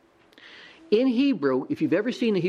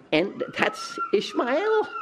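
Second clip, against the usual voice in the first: pitched way up and slowed, hammering the name home; emphatic high exclamation; slower than usual. Real, not acted. Preacher to congregation, emphatic high cry naming Ishmael. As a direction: land the name like a verdict.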